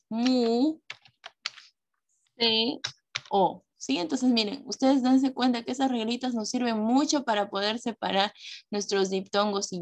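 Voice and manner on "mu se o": "Mu, se, o" in separate syllables, long pauses between. Shown as a demonstration. Teacher showing pupils how to split museo, syllable by syllable. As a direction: break it into "Mu", "se", "o", one at a time. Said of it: "¿Cómo sería el silabeo?".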